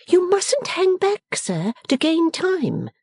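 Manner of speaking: high, drawn-out playful scolding; crying out. A narrator's reading. Bella to her stalling father, crying out in mock command. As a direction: chide him in a high, slow voice. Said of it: "cried Bella".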